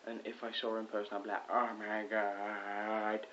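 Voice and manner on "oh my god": drawn-out, slow fangirl gush; mock fangirling. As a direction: stretch it out like a starstruck fan. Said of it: "I do fangirl".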